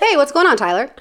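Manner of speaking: high, sudden friendly greeting; Carla. Retold or imitated. Bounty hunter greets the man she just insulted, imitated chirpy hello. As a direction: switch instantly to a cheery hello with a laugh at the end. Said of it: "So this is me doing her".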